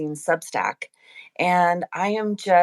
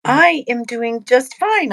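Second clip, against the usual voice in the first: sing-song and a little higher and louder; happy; 5 semitones higher, 6 dB louder than usual. Real, not acted. Co-host answers the host's greeting in a happy lilt. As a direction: answer brightly, lifting the line. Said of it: "friendly, happy voice".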